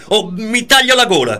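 high and loud imitated threat; mock desperate yell. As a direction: fling out the threat in her voice.

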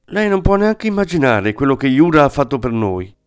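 put-on a young exile pleading warmly, pitched high; Dola, eager young woman. Dola voiced by narrator.

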